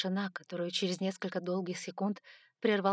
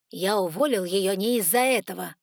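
louder, annoyed correction; irritated reply; 8 dB louder than usual. A narrator's reading. Jefferson explaining why he fired her, irritated. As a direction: answer back with irritation. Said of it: "раздражённо ответил Джефферсон".

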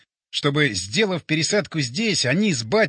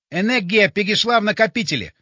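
higher, effortful report; forced out with effort; 4 semitones higher, 4 dB louder than usual. A narrator's reading. Borya weighed down by heaviness, forcing words out. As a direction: push the words out under strain. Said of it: "выдавил он".

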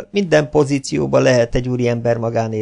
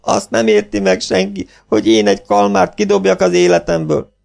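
tearful outburst; 7 semitones higher, 3 dB louder than usual. Acted. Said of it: "És már is sírva fakadt".

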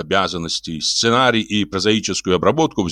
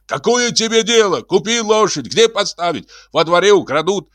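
high, heated retort; irate neighbour defending himself; suddenly angry; 11 semitones higher than usual. A narrator's reading. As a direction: argue back crossly, voice pitched way up. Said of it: "Шаринов вдруг рассердился".

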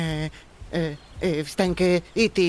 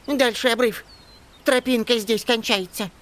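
urgent warning; 6 semitones higher than usual. A narrator's reading. Elf warns of cliff edge.

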